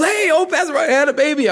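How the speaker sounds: high, eager blurt; excited new dad. Retold or imitated. New father greets pastor in lobby, high and eager. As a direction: burst out with the big news. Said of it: "He goes".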